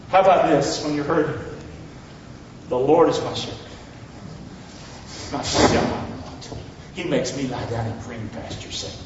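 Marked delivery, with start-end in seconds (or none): 2.7-8.9 soft tender reading. Acted.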